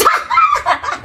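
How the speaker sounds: sudden high-pitched laugh. Real, not acted. Vlogger amused by her own jab, high laugh. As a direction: burst into a high cackle.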